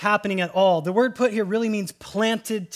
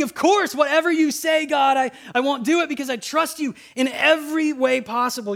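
eager agreement; 8 semitones higher, 5 dB louder than usual. Pretend. Adam eagerly answering God.

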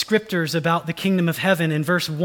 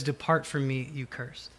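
softer, grave sentence of judgment; solemn rejection; 4 semitones lower, 9 dB quieter than usual. Acted. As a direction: let it fall away quietly, final. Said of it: "he says".

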